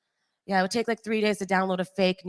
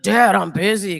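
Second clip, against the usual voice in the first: a hint of a macho man's voice, a bit louder; mock manly voice; 7 dB louder, slower than usual. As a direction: puff up your voice like a tough guy.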